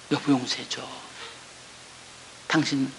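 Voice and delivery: soft, low begging; hushed plea to husband. Retold or imitated. Wife on her knees to her husband, soft plea. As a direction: murmur it on your knees.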